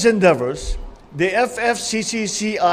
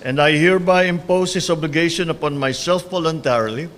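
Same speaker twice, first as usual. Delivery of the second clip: solemn, measured oath-taking; court oath. Acted. Administers the oath of office.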